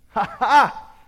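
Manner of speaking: loud, high mock laugh; gloating laugh. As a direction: crow "ha ha" at your rival. Rival gloats before the race.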